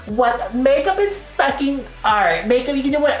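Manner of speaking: high-pitched indignant outburst; outraged commenter. Retold or imitated. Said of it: "people in the comments are like".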